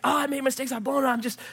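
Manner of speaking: high-pitched, anguished mock wail; imitated torment. Pastor acting the tormented woman's regret, imitated anguish. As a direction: imitate someone distraught with regret.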